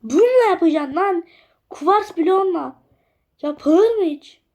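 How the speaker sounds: high, loud self-scolding; high and loud, mock-outraged at your own idea; indignant outburst. Real, not acted. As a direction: tell yourself off, outraged. Scolding himself over quartz blocks.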